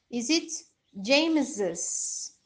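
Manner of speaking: James's said James-iz, slow and careful; slow teacher demo of James's with the extra syllable. Shown as a demonstration. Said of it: "Muda um pouquinho a pronúncia".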